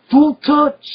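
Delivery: high, loud panicked yell imitating Arnold; Arnold's shouted warning. Action hero yelling at a clumsy cop, loud high imitation. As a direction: bark it out like an action hero stopping a rookie. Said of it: "I just love Arnold's".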